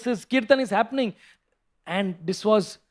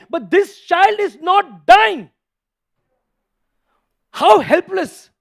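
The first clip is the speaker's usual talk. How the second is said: impassioned; 7 semitones higher, 4 dB louder than usual. Real, not acted. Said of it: "what sort of anger he must be having".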